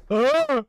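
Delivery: high, loud startled yell; startled scream. Real, not acted. Gamer trying to leave gets startled, screaming. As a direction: cry out mid-word in fright.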